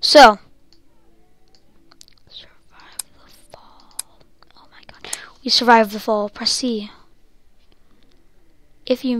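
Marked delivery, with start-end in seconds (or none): 2.3-5.1 hushed breathy whisper. Real, not acted. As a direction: whisper it in suspense. Whispering suspense in game.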